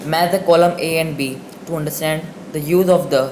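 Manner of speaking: slow, loud dictation of the rule; slow rule reading. Shown as a demonstration. Teacher reading a grammar rule aloud to students, slow and deliberate. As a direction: read the grammar rule slowly, word by word. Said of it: "reads worksheet instruction slowly".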